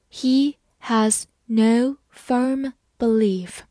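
very slow, each word apart; slow reading. Shown as a demonstration. Teacher reads slowly for students to follow. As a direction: read it slowly for learners. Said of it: "好，慢慢来读。".

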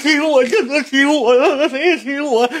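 very high, wailing imitation of a crybaby; hammed-up tearful whine; mock crying. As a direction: imitate someone sobbing while throwing punches. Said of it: "他边打边哭".

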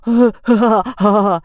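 hearty pig laughter; big snorting laugh; pig's belly laugh. A narrator's reading. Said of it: "小猪哈哈大笑着说".

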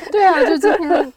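bursting into laughter; laughing while agreeing. Real, not acted.

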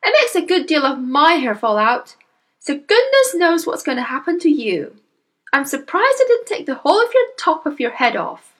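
lightly voiced high-pitched mother; mother in the story. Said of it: "the mother told him".

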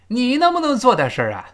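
你 and 做 pushed high, falling at the end; stress on 你 and 做. Shown as a demonstration. Teacher showing the stress through Chinese, emphatic 你 and 做. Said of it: "那个do要重读".

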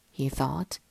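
usual speaking voice

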